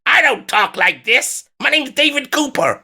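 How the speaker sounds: co-host parroting the other's words in his voice; David Cooper impression. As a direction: keep up the teasing imitation of your friend. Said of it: "let me do a David Cooper voice".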